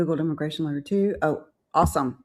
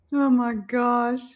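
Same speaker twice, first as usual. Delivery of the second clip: gasping surprise; 4 semitones higher, much slower than usual. Real, not acted. Gasps at a birthday.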